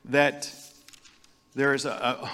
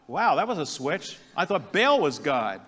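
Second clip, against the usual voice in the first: usual speaking voice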